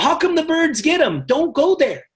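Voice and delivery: normal talking voice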